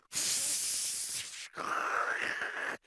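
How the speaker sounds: long rushing wind noise; mouth-made roar of high wind; imitated howling wind. Shown as a demonstration. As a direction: blow a long gust like a gale.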